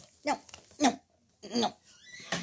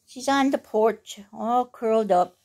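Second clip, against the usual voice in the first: plain reading voice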